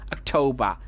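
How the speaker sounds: usual speaking voice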